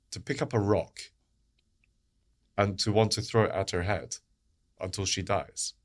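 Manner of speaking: low, soft, heavy delivery; hushed horror. Real, not acted. Commentator describing a girl being stoned, hushed horror. Horrified account of a stoning.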